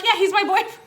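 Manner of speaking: high, laughing retold insistence; giggly imitation of herself protesting.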